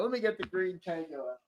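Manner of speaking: usual speaking voice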